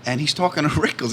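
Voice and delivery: ordinary talk, nothing marked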